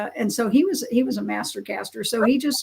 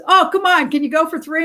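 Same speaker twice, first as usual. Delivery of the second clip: higher and louder, egging him on; eager challenge; 5 semitones higher, 6 dB louder than usual. Retold or imitated. Eisenhower urges Dad for a third cast, excited and loud. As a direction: push him to try once more.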